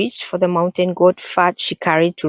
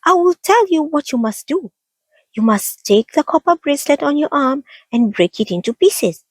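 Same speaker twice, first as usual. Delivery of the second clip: tiny mouse squeak, much higher; high squeaky voice; 10 semitones higher than usual. A narrator's reading. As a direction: squeak like a little mouse woman. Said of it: "Mouse Woman squeaked".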